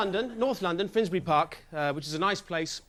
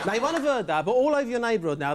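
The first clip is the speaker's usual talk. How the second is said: ordinary talk, nothing marked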